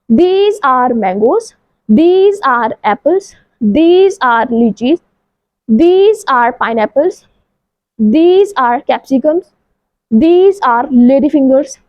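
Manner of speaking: very slow, each word spaced out; slow drill. Shown as a demonstration. Teacher leading a vocabulary drill, very slow. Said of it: "slow बोलते हैं".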